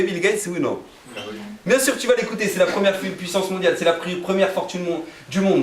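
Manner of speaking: ordinary talk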